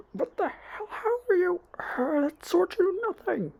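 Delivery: very high, wailing disbelief; acted villain shock. Sludge villain losing part of his arm, acted shocked wail. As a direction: wail it high, stretching the end. Villain reacts to being slashed.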